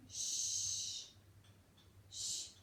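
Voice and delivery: long unvoiced hissing sh; snake hiss sound. Shown as a demonstration. Teacher showing the snake's sound to pupils, hissing.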